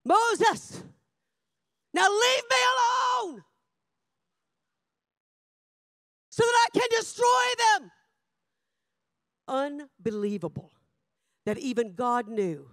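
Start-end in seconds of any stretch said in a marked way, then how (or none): 0.0-7.9 angry God. Acted. God ordering Moses to leave him alone, angry shout. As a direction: roar it as a furious order. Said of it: "that's why God was so angry".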